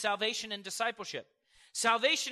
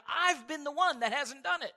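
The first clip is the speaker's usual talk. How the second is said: ordinary delivery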